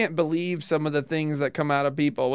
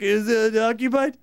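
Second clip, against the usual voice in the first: imitating a badly disguised voice; awkward fake voice, mocking; 3 semitones higher than usual. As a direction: put on an odd stall-occupant voice. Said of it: "Trying to disguise his voice".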